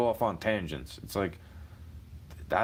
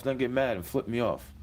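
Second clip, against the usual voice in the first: ordinary talk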